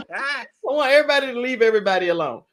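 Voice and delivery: high, quick repeat through laughter; amused, laugh-filled voice. Real, not acted. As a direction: crack up while saying it. Repeats the line while laughing.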